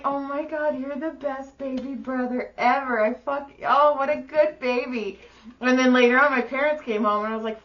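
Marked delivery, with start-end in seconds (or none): 0.0-5.2 put-on higher, cooing "what a good baby"; mock-gushing praise; gushing big sister. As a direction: coo like to a baby.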